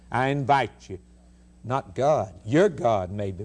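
ordinary talk, nothing marked

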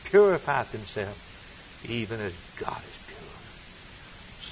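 slow, soft, nearly whispered; slow hush. Real, not acted. Preacher closing the verse in a slow hush. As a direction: draw it out in a reverent hush. Sermon, slowed hushed close.

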